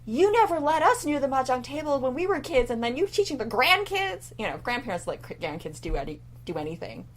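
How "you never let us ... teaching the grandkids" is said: high-pitched retold indignation; mad mom. Mom scolding grandpa over mahjong lessons, mock indignant. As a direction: protest like an aggrieved mom, pitch climbing. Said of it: "My mom got so mad".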